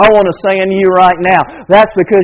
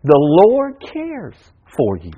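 tender hush; 5 semitones lower, 7 dB quieter, slower than usual. Real, not acted. Preacher softly closing point.

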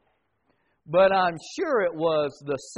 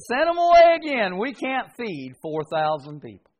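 disciples pleading; 3 semitones higher, 7 dB louder than usual. Retold or imitated. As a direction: cry out send them away. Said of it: "They said".

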